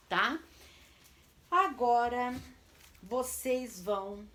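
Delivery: normal talking voice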